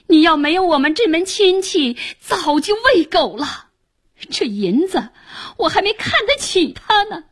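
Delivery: high, fast, angry retort; old father throwing scorn at his son-in-law; indignant outburst. A narrator's reading. Old father flings the silver back.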